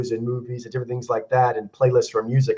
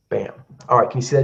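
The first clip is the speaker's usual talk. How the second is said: ordinary delivery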